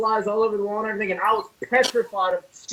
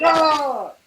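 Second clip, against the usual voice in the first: higher, louder drawn-out "oh"; mock horrified cry; 5 semitones higher, 5 dB louder than usual. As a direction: re-enact a horrified yelp. Retelling own horrified reaction to scene.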